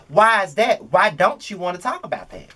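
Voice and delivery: ordinary talk, nothing marked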